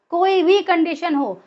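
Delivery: normal talking voice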